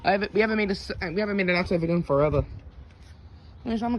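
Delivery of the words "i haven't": ordinary talk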